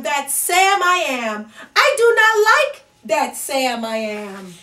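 usual speaking voice

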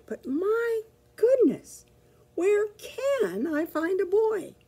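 high, slow plaintive cry; a tired little dog wailing; despairing puppy. Acted. Petey lamenting to himself, high and slow.

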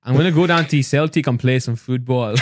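party-piece Scottish accent. Shown as a demonstration. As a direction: call it out in a put-on Scottish accent. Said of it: "Have you heard his Scottish accent".

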